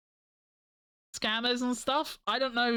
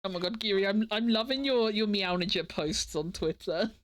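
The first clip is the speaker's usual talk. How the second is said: usual speaking voice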